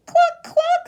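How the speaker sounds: high, loud hen cluck; squawky chicken noise. Acted. Voices the chicken.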